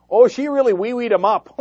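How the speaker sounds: mock folksy Palin-style accent. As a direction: laugh your way through a hammed-up Palin-style folksy accent. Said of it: "they have the same accent".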